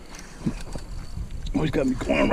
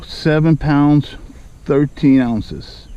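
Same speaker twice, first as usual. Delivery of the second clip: slow announcing; much slower than usual. Real, not acted. Reading the scale.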